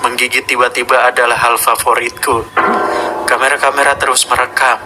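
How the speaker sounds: a sinister Godzilla speaking from the tape; monster voice. Acted. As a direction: growl out the kaiju's confession. Hidden message from the monster.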